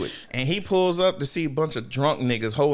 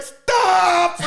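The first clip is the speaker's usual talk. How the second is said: high-pitched, loud shriek; Botan; 7 dB louder than usual. Retold or imitated. Botan halting the fight, hammed-up shriek. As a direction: scream it high as a desperate halt.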